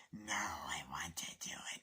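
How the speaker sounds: slow, soft, low whisper; calm psycho whisper; hushed sinister reply; creepy whisper. Retold or imitated.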